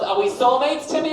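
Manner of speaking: loud high mock wife; imitating wife. Wife nose to nose asking Timmy, loud mock voice. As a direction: ask it nose to nose, pushy. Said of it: "my wife saying that to me".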